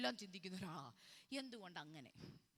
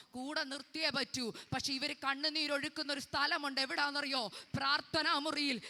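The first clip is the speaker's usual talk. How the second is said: raised, insistent pulpit exhortation; fervent preaching; 5 semitones higher, 10 dB louder than usual. Real, not acted. Preacher tells how Jesus prayed for betrayers, fervent raised preaching. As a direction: press on loudly in a higher preaching voice.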